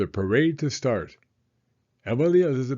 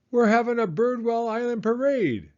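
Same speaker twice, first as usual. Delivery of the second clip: much higher, calling out; shouted; 11 semitones higher, 4 dB louder than usual. Acted. Emily Elizabeth calls to friends in the park, shouting happily. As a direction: call across the park to friends. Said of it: "Emily Elizabeth shouted to them".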